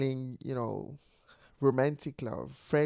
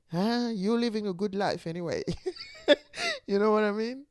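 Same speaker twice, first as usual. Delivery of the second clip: real laugh breaking out, higher pitch; 8 semitones higher than usual. Real, not acted. Vlogger finding a good life amusing, laughing. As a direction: let the line tip over into a laugh. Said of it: "I'm laughing because I".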